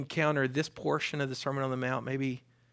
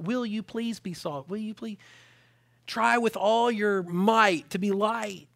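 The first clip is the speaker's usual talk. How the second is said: mock pleading; 6 semitones higher, 5 dB louder, slower than usual. Mock pleading Jesus never said.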